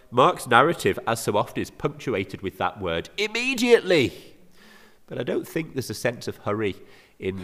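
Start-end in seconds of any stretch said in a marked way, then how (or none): 3.2-4.4 "immediately" pitched very high; urgent emphasis. Acted. Preacher quotes Mark's recurring word to the congregation, urgent emphasis. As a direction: burst out "immediately" high and insistent.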